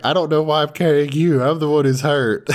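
high, quick put-upon grumbling; mock complaint. Hurt girl carrying the crying boy, mock exasperation.

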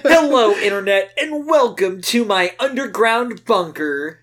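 a hint of a hyped YouTube host; mock YouTuber greeting from a bunker; lightly voiced MatPat. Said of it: "I had to assassinate MatPat".

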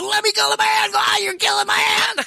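very high strangled squeal; mock agonized wail; pained protest. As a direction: imitate someone yelping as a hand is crushed. Hand crushed, crying out.

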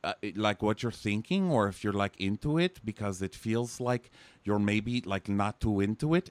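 loud put-on Russian accent; hammed-up Russian woman. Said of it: "Now I'm trying to do yours".

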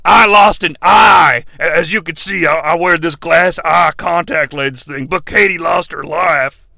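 low, solemn mock-confession voice; mock-mournful low wrestler; Kane. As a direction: play it low and grave, hamming up the regret. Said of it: "have him be like".

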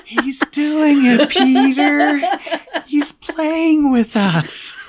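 high, slow giggling words; laughing. Real, not acted. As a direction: laugh through it. Laughing at Peter's slip.

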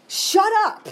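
high, loud mock snap; fed-up mock retort at advice givers. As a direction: bark it like you want to kick them. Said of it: "I wanted to kick them".

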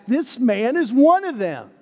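put-on high, pointed servant girl voice; emphatic accusation. Servant girl to bystanders, accusing Peter, high and insistent. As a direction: jab the finger: one of them. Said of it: "the girl is even more emphatic".

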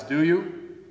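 ordinary talk, nothing marked

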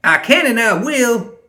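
very high, loud and drawn-out retort; defiant high-pitched snap; snapped. A narrator's reading. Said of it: "Ma snapped".

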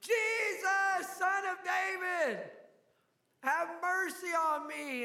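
high, loud desperate cry for help; desperate shout. Acted. Blind beggar shouting to Jesus for mercy, loud desperate cry. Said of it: "he just got louder".

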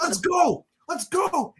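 high-pitched rallying cry; hyped up. Retold or imitated. Fan fired up by the wrestler's promo, hyped cheering.

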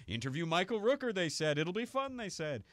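exaggerated high-pitched mock complaint; hamming it up. Panel host playing up the ordeal for the audience, hammed-up. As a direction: ham it up, pitch leaping high. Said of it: "like, hamming it up at that point".

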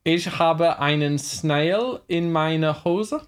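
slow mock German through laughter; drawn-out put-on German, amused; strong German accent. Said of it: "a really strong accent".

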